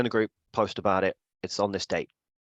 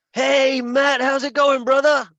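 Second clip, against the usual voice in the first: much higher, loud, shouted friendly greeting; Devon impression; 8 dB louder, slower than usual. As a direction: boom a hearty hello down the phone. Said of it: "I'm gonna do an impression of you".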